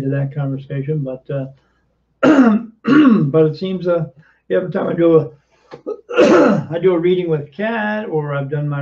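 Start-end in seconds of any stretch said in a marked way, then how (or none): 2.2-3.2 loud throat clearing; coughing fit. Real, not acted. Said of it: "sorry, I'm coughing so much here". 5.7-6.6 loud throat clearing; coughing fit. Real, not acted. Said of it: "sorry, I'm coughing so much here".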